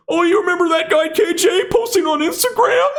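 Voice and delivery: very high, shouted mock voice; sarcastic falsetto of future grandkids. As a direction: ham up a squeaky grandkid. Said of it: "They aren't gonna be like".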